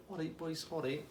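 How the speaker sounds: high, soft, easy-going imitation; chilled out. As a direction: placate them lightly, totally unbothered. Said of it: "He's just chilling out".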